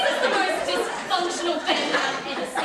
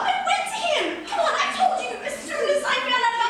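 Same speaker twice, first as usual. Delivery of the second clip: laughing; 8 semitones higher than usual. Real, not acted. Laughing protest at co-actor.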